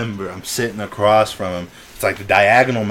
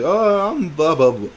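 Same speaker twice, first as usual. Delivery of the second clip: high-pitched mocking "oh" to start; mock tough boasting; 7 semitones higher, 3 dB louder, slower than usual. Short kid shows off how tough he is, mocked boasting. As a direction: imitate a kid bragging, dismissively. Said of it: "super tough".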